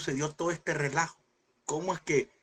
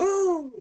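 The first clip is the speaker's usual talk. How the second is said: high, louder whoop; mock revival whoop; 14 semitones higher, 5 dB louder than usual. Worshipper's revival cry imitated in the service. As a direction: mimic a revival shout. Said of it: "Grito de avivamiento".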